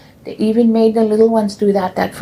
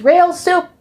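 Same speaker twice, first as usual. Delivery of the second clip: teasing dad; 10 semitones higher than usual. Retold or imitated. Dad joking answer.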